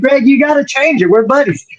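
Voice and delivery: retold pleading rant. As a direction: beg a buddy half-joking. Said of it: "I was real mad".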